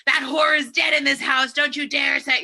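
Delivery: hammed-up outraged old man, half laughing; grandfather's outburst, played for laughs; laughing mock fury.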